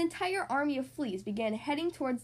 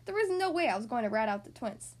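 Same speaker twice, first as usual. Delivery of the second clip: usual speaking voice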